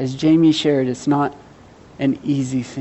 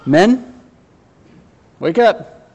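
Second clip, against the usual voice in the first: calling out; 4 semitones higher, 8 dB louder, much slower than usual. Real, not acted. Calling the men to wake up.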